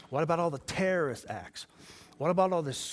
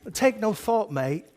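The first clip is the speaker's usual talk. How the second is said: put-on Australian accent; 7 semitones higher, 7 dB louder, slower than usual. Shown as a demonstration. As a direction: demonstrate a loud, high mock-Australian accent. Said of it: "use an accent".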